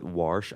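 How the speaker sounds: "warsh" with an added R; dialect pronunciation. Shown as a demonstration. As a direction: say wash as "warsh", Baltimore style. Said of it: "I used to say".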